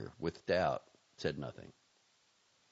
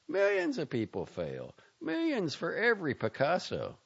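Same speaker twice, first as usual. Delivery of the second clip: higher, louder cynical friend; Jack lecturing; 7 semitones higher, 5 dB louder than usual. Acted. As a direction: lay down hard truths. Jack keeps lecturing Valenti.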